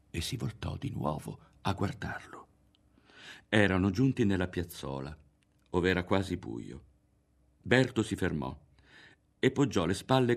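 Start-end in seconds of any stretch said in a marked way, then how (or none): none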